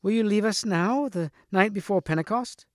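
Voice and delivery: high-pitched, dismayed question without shouting; protesting cry. A narrator's reading. Guinevere to Lancelot leaving before Pentecost, protesting cry. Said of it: "cried Guinevere".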